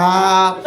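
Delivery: loud bull snort ah. Retold or imitated. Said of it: "my bull impression".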